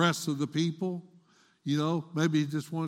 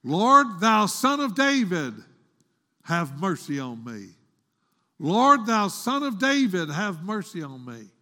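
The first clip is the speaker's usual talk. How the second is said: crying out pleading; 2 semitones higher, 4 dB louder than usual. Retold or imitated. Canaanite woman begs the Lord for mercy, crying out. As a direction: call out to the Lord with all your heart. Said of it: "crying to the Lord with all of her heart".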